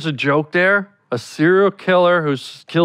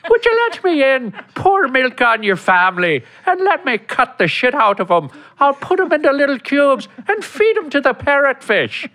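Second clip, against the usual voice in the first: high, creepy Irish leprechaun voice; Lucky the leprechaun voice; 9 semitones higher than usual. Pretend. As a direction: do a menacing leprechaun. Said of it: "over here we got Lucky".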